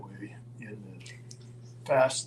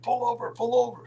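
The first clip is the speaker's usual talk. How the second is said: high, pressing imitated urging; urgent plea; 11 semitones higher, faster than usual. Passenger telling Roddy to stop for police, urgent. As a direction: urge it twice, pitched well up.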